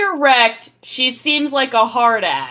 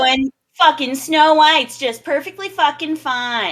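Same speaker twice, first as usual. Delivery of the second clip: slow, higher mock rant; Grendel going off; 4 semitones higher, slower than usual. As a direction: drag out the sarcastic complaint, imitating his tirade. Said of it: "Grendel went off like".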